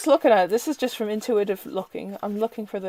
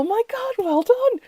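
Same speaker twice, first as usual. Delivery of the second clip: much higher, gushing congratulation; mock cheering; 13 semitones higher, 4 dB louder than usual. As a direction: gush with joy for a friend's promotion. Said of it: "give it to yourself going".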